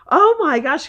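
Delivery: excited daughter. Retold or imitated. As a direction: squeal it like a thrilled kid. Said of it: "she was sitting there going".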